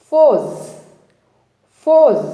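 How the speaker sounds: loud, high, falling "ఫోజ్" with no r; corrected pronunciation. Shown as a demonstration. Teacher introducing the next word, emphatic "ఫోజ్" without r. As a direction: punch out "ఫోజ్" as one word, r left silent. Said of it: "pronunciation correct చేసుకోవాలి".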